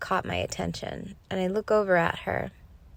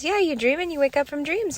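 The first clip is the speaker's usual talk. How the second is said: high, singsong imitation of a toddler; higher child voice, matter-of-fact and bubbly; little girl herself; 9 semitones higher, 4 dB louder than usual. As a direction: parrot it back like a carefree small child. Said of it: "And she's herself".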